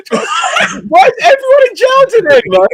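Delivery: very high, loud, drawn-out cry; exasperated high yell to the room; incredulous shout. Real, not acted. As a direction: shout it in comic disbelief. Host mocks callers' bad video.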